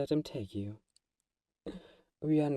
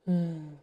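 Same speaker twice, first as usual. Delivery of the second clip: heavy sigh; 7 semitones higher, 4 dB louder than usual. Acted. Vampire confronting hunter friend.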